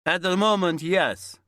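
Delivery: put-on old window cleaner answering in Italian-accented English. A narrator's reading. Terry asks the window cleaner if he works alone, Italian accent. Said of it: "the man said in an Italian accent".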